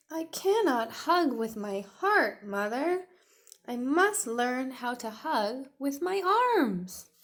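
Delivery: high, loud little dinosaur voice; Tiny's voice. Acted. Tiny answering his mother, high and earnest. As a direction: play an earnest small T-Rex.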